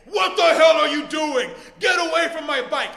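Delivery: loud, high mock-furious dad shout; angry dad voice. Owner confronting a bike thief, angry dad voice. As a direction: yell it like an outraged father. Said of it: "in my best angry dad voice".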